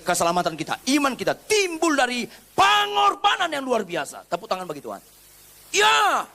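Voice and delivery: blazing preacher's climax; fervent preaching shout. Acted. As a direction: cry it out to the congregation.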